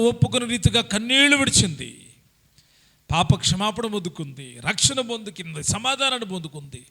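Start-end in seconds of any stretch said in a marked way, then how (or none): none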